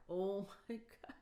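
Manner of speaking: slow, hushed whisper of disbelief. Retold or imitated. Hurt daughter reliving the moment, slow whisper. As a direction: breathe it out slowly in shock. Retelling crying over father's ingratitude.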